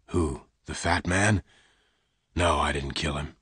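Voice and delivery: calm her quietly; low soft gunman. Acted. Lassiter speaks to Jane.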